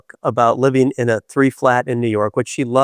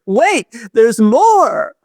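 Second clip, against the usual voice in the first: big slow drawn-out pitchman voice; booming commercial pitch, high and loud; infomercial announcer; 10 semitones higher, 5 dB louder, much slower than usual. Retold or imitated. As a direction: sell it like a late-night TV ad. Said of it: "that's what we should say".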